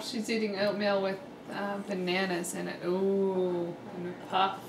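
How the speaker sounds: soft, low narration aside; quiet aside. Real, not acted. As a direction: murmur it to the camera. Quiet aside about baby eating.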